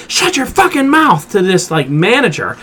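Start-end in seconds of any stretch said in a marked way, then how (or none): 0.1-1.3 angry snarl. Retold or imitated. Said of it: "And he goes".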